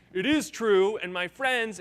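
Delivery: loud, insistent mock retort; piling on. Online commenters pile on the company, loud mock retort. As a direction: shout back as the online crowd. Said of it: "five hundred responses after that".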